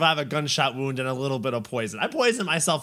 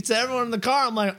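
usual speaking voice

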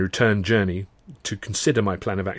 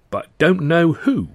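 incredulous; 7 semitones higher, 4 dB louder, slower than usual. A narrator's reading. Clerk incredulous.

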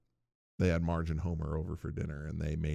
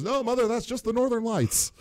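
higher, brushed-off reply to mother; mock Skinner; 14 semitones higher than usual. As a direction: wave off mother's alarm in a higher voice. Skinner answering his mother.

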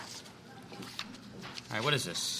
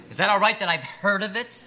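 higher and much louder, pushing harder; sarcastic outburst; 6 semitones higher, 6 dB louder than usual. Acted. Interviewer escalating at the applicant over Pepperdine, indignant and loud. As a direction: ramp the mockery into a near shout.

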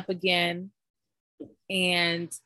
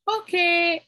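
mock high okay; 11 semitones higher, 5 dB louder than usual. Mom humoring the staff, retold.

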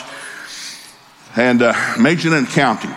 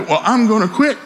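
high-pitched imitation of an upset daughter; 9 semitones higher than usual. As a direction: put on a high, indignant daughter voice. Upset daughter threatens to quit.

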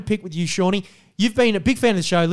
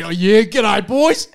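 imitating callers' Aussie g'day, pitched up; mock-Australian caller greeting, a bit louder; broad Australian accent; 4 semitones higher, 4 dB louder, much slower than usual. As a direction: put on a hearty Aussie caller voice. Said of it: "what an Australian accent. Everyone's answering".

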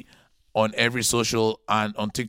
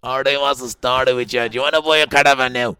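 put-on broad Irish accent, louder and fast; 2 semitones higher, 8 dB louder than usual. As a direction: lay on a thick Irish accent, loud and fast. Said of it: "Give us your best Irish accent".

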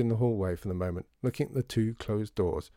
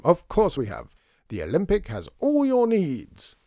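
high, loud, slow salesman's slogan; showy flourish; 7 semitones higher, 5 dB louder than usual. A narrator's reading. Shopkeeper boasting of his store to Lydia, with a flourish. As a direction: proclaim it as if rehearsed. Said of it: "He said this with a flourish".

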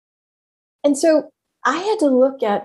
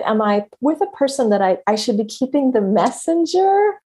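ordinary talk

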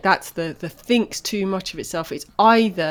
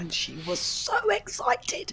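hushed whisper, much higher; 12 semitones higher, 7 dB quieter than usual. Real, not acted. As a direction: whisper it like a secret. Telling about the child.

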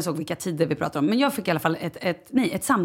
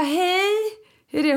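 much higher, bright put-on politeness; chirpy fake-cheerful greeting; 12 semitones higher than usual. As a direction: sing out the hello to hide the worry. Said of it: "Jag bara".

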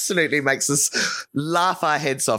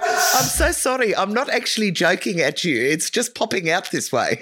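put-on South African accent; 4 semitones higher than usual. Apologising to a South African on a Zoom call, in her accent. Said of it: "I went straight into the accent".

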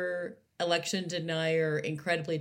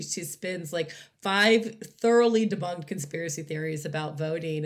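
normal talking voice

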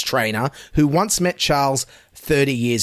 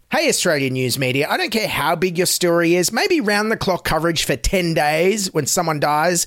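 ordinary talk, nothing marked

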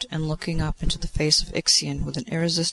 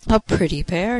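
higher, louder mocking exclamation; exclaiming; 4 semitones higher, 6 dB louder than usual. A narrator's reading. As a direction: tease the couple with a raised, gleeful voice. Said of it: "he exclaimed".

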